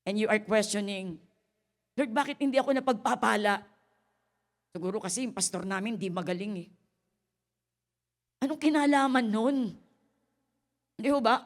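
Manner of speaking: loud, high preaching. Acted. Pastor preaching to the congregation, loud and high. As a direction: preach it with full force.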